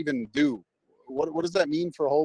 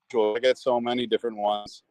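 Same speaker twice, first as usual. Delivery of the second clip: ordinary delivery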